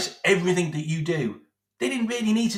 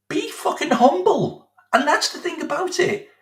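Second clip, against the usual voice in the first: much higher, incredulous scolding; higher exasperated scolding; 11 semitones higher than usual. Real, not acted. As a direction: scold him in disbelief. Berating the manager.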